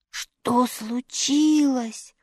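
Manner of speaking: slow, grieving question; sorrowful. A narrator's reading. Scarecrow over wounded dog, slow sorrowful question. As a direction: ask it heavy with grief. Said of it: "горестно спросил Страшила".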